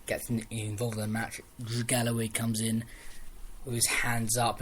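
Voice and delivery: usual speaking voice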